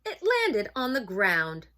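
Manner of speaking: loud, dramatic storybook reading; story narration. A narrator's reading. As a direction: build suspense for children, punching the action.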